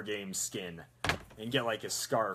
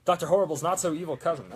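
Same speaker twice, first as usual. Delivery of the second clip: mock villain announcer; 5 semitones higher, 6 dB louder, faster than usual. Corrects mock villain introduction.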